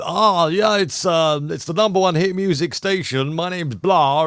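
high-pitched mock station DJ patter; hit-radio DJ. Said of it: "radio stations that I'm talking about, that will go".

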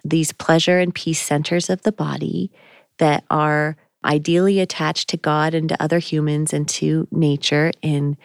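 normal talking voice

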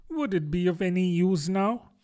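a hint of character in Alice's thought; Alice thinking. A narrator's reading. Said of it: "thought Alice".

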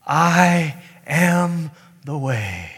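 very slow, weighty words; solemn declaration. Acted. Jesus answering wandering sheep, slow solemn declaration. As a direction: space out each word deliberately. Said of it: "And what does Jesus say?".

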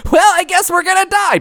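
very high, nasal whiny man voice. Retold or imitated. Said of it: "a very high-pitched, nasally voice".

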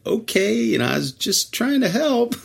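defensive. Retold or imitated. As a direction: play Rob backing off, hurt. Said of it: "Rob's like".

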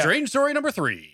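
high, drawn-out mock announcer call; hammed-up game-show intro; segment announcer. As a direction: sing out the segment title up high, stretched.

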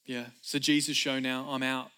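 low, offhand throwaway; casual, unbothered. Acted. Preacher mocking an uninterested John, nonchalant. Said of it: "put his hands in his pockets and just was like".

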